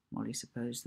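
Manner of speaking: ordinary delivery, nothing marked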